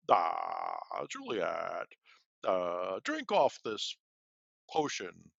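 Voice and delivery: wild put-on apothecary voice, pitch jumping; [name] as the apothecary handing Juliet poison, hammed-up voice. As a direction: ham up the apothecary offering poison. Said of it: "doing the apothecary in a different voice".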